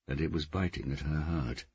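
ordinary delivery

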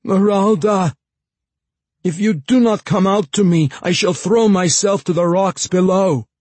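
loud, high desperate threat; yelling. A narrator's reading. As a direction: shout the threat down. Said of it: "Jacka yelled suddenly".

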